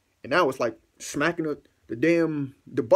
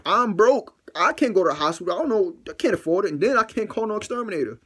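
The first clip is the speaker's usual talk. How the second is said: higher, insistent complaint; exasperated pleading; 7 semitones higher, 3 dB louder than usual. Real, not acted. Broke man explains why he can't call an exterminator, exasperated plea. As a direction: plead hardship, pitched up. Pleads his case to listeners.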